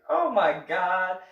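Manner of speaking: loud, drawn-out exasperation; acted weary dismay; exasperated. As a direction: groan it out as a fed-up grandpa. Retold grandpa exasperated, drawn out.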